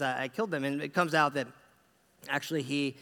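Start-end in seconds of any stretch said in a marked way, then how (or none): none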